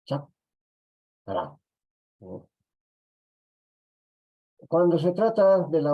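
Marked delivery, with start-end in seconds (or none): 0.1-1.6 "chakra" as chak, long pause, ra; Quechua syllable split. Shown as a demonstration. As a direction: demonstrate "chakra" slowly in two separate syllables. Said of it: "en quechua".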